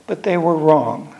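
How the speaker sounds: usual speaking voice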